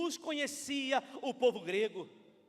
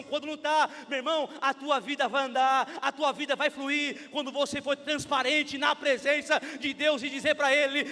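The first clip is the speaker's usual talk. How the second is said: loud, driving sermon delivery; impassioned pulpit shout; full-force preaching; 6 dB louder than usual. Real, not acted. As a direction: preach it straight at the congregation, full volume.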